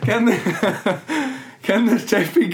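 losing composure. Real, not acted. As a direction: try to speak while cracking up. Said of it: "Let me get my composure".

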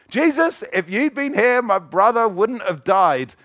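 high, loud accusing wail; accusing blame. Retold or imitated. Mary blaming Jesus for her brother's death, accusing. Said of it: "That's called blaming somebody".

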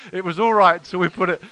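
ordinary talk, nothing marked

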